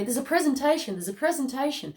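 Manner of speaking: playful mock announcer; theatrical announcement. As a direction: call it out like a herald. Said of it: "coming through as a theatrical thing".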